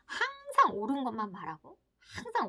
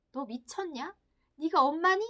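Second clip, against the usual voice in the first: self-reproaching; 5 semitones higher, 4 dB louder than usual. Acted. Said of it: "막 자책을 하는 거예요".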